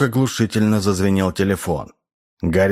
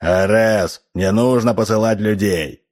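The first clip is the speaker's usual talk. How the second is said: plain reading voice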